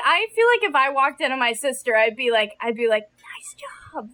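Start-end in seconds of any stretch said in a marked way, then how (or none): 3.0-4.1 hushed, voiceless aside; mock whisper. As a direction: whisper it like a sly compliment. Imagined remark to sister, whispered.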